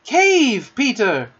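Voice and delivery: high, loud warning cry; calling out. A narrator's reading. Smallest star warns Peter, high urgent call. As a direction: shout an urgent warning from afar. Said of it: "called out".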